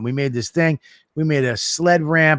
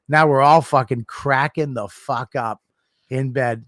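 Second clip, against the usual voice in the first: ordinary talk, nothing marked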